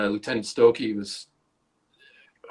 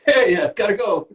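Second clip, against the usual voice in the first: brushing off; 11 semitones higher, 5 dB louder than usual. Retold or imitated. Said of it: "you're like".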